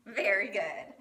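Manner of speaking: normal talking voice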